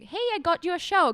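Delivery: usual speaking voice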